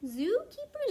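plain reading voice

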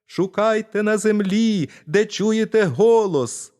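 put-on high, loud little-boy voice, slowing at the end; a tiny lad eager to help; tiny boy's voice. Tiny boy offers to help the thieves, high and eager. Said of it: "відповів він".

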